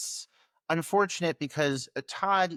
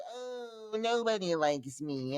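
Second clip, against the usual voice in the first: high, drawn-out mock whimper; slow self-pitying whine, mocked; 7 semitones higher, 5 dB quieter, much slower than usual. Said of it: "Alfred throws him this whole".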